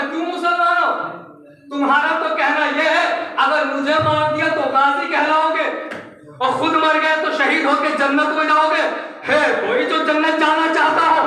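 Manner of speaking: booming battlefield taunt; shouted challenge. Retold or imitated. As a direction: shout the dare to the whole army. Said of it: "ये चिल्ला चिल्ला के कहता".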